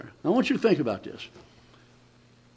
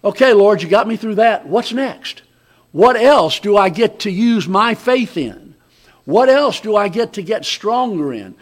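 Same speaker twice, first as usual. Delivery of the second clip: eager, louder, confident; right inflection; 3 semitones higher, 6 dB louder than usual. Shown as a demonstration. Preacher showing the right way to ask God, eager and bold. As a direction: ask eagerly for the next challenge. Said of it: "The right inflection is".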